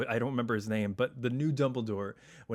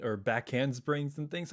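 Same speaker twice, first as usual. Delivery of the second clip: usual speaking voice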